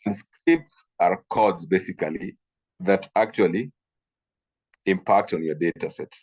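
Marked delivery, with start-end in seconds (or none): none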